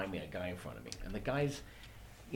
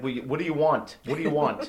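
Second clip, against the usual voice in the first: louder, curt and repeated; a fed-up counterman pushing for the order; impatient deli man; 4 semitones higher, 7 dB louder than usual. Retold or imitated. Deli counterman snapping at the chatty tourist for his order. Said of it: "He's like".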